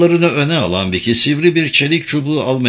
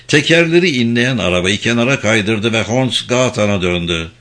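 plain reading voice

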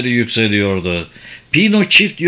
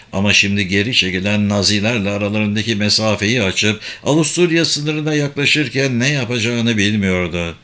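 plain reading voice

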